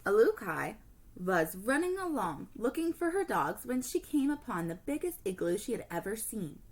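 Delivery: ordinary delivery, nothing marked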